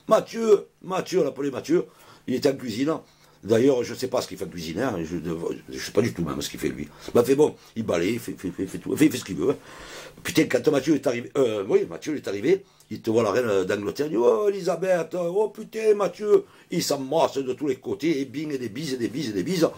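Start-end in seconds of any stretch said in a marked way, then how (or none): 0.1-1.2 calling out a name. Pretend. Calling Mathieu from the kitchen. 14.2-16.4 high, excited cry of recognition; thrilled to see her; delighted greeting. Acted. Mathieu greets the Queen delightedly.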